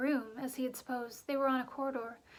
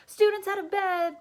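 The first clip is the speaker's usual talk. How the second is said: high, loud, slow bellow; gleeful hollering alarm, high and loud; 7 semitones higher, 11 dB louder than usual. A narrator's reading. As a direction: roar it to wake the castle. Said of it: "Peeves bellowed".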